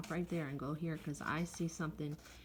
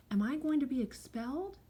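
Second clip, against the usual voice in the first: worried question; 7 semitones higher, 4 dB louder than usual. A narrator's reading. Worried pupil asks teacher.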